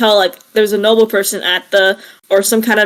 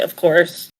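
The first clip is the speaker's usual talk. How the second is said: usual speaking voice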